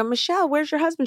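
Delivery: high, lightly put-on curious voice; high imitating voice to the tailor; mock innocent question. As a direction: ask it sweetly, suspecting nothing. Asking the tailor about husband.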